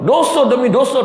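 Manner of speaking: preacher crying out against sin, loud and high; impassioned outcry. Real, not acted. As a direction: call it out like a warning from the pulpit.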